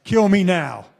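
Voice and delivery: loud, pleading kill me now; begging in agony. Retold or imitated. Acting out a man with crushed balls, loud pleading. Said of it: "all of us are just like".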